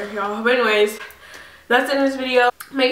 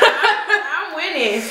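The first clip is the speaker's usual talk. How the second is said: laughing; 6 semitones higher, much slower than usual. Real, not acted. Laughing mid spicy challenge.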